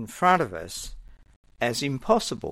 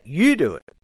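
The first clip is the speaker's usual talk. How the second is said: "You" hit hard and louder; emphatic you; 3 semitones higher, 5 dB louder than usual. Shown as a demonstration. Jesus pressing his disciples, louder emphatic "You". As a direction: punch the word "You" as a command. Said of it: "is very emphatic".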